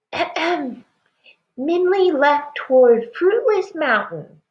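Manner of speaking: put-on high, light child voice; small boy. A narrator's reading. Small boy tells the parents where Minli went, high child voice. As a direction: clear your throat like a little boy. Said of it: "he said".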